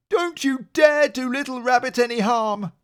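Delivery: high, loud furious warning, stress on dare; angry cry. Acted. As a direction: threaten the bear to protect your child. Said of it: "he cried".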